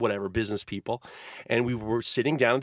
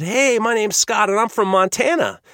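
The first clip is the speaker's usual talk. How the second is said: higher, louder hearty greeting; camp counselor; 9 semitones higher, 5 dB louder than usual. Retold or imitated. Camp counselor greets a boy off the bus, higher and louder. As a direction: introduce yourself loudly as a counselor. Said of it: "the camp counselor said".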